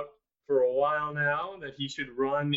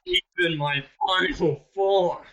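mock monster; 5 semitones higher, 4 dB louder than usual. Joking monster reveals true form.

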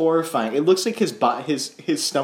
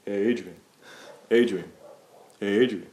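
mock Rocky; 4 semitones lower, 4 dB quieter than usual. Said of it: "the whole movie can be described with him going".

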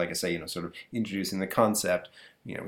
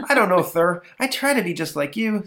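put-on much higher kid voice; innocent boy; 9 semitones higher, 5 dB louder than usual. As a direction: answer dad with innocent cheek. Boy answers dad.

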